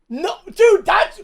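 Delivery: loud, high-pitched disbelief; real shout of amazed protest; incredulous outburst. Real, not acted. Viewer stunned the crowd isn't laughing, incredulous shout. Baffled nobody laughs at the joke.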